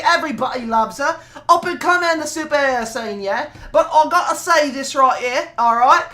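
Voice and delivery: put-on British accent. Praising Sailor Moon to the audience in a put-on British accent. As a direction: banter about Sailor Moon like a London lad. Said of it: "turning into Cockney".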